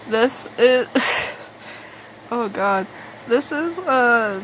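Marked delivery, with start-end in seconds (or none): none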